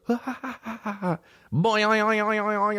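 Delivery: high springy boing sound effect; imitated spring wobble held high; cartoon boing. Imitating a prank show's sound effects, high silly boing. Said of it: "then it goes".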